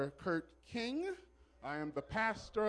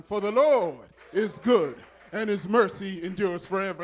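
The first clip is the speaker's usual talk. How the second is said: much louder, fervent proclamation; emphatic repeat; 10 dB louder than usual. Real, not acted. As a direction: preach it out loud to rouse the congregation. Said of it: "say it one more time".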